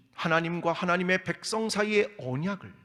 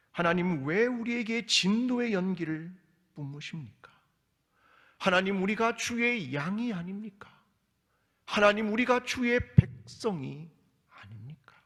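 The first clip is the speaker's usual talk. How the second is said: rising, louder pleas to God; pleading complaint; 6 semitones higher, 5 dB louder than usual. Acted. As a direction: demand an answer from God, voice rising. Preacher voices psalmist's complaint. (하나님께 따지듯 높아지는 탄원)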